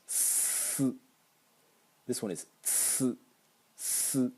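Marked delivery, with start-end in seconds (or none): 0.1-0.9 the second-row consonant as an unvoiced hiss; noise-only hiss. Shown as a demonstration. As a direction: hiss it, no burst. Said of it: "This is only the noise".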